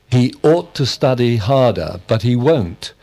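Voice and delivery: "ought" stressed with emphasis. Shown as a demonstration. Student answering with an emphatic example, stress on "ought". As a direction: lean firmly on "ought". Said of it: "when we wish to be emphatic".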